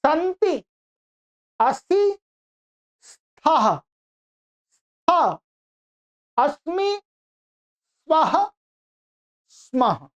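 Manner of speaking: high, careful chant of each verb form; teacher modeling Sanskrit forms; model recitation. Shown as a demonstration. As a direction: say each form clearly for students to copy. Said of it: "एक बार सुनिएगा, पहले मैं बोलता हूं".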